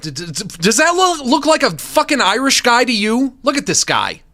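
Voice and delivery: stammering, loud, high incredulity; mock outrage. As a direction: sputter loudly at an absurd suggestion. Mock outrage at Irish suggestion.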